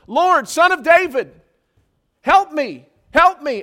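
loud, high desperate cries for help; screaming plea. Acted. Woman following the disciples calls after Jesus, screaming plea. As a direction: call out urgently after someone walking away. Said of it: "she's screaming after these guys".

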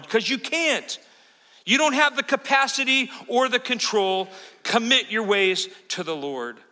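forceful preaching. Real, not acted. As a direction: drive each line home like a preacher.

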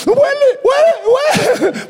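calling out that she can smell; excited exclaim. Retold or imitated. Healed person crying out to the pastor in joy.

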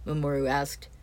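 plain reading voice

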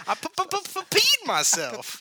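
high stuttered pa-peed, pa-pa-pa-peed; mock stutter. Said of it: "are you stuttering like".

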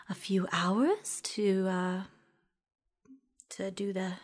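slow unvoiced whisper. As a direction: drop to a murmur mid-thought. Shopkeeper trailing whisper.

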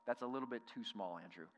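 low aside, dropping away on the name; mock doubtful aside. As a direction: gently put down a friend's idea under your breath. Disciples privately doubting Andrew's idea.

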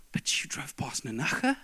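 whispered disbelief; incredulous inner whisper. Retold or imitated. Husband's unspoken thought about wife skipping supper, whispered. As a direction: hiss it under the breath, stunned. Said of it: "everything in me just went".

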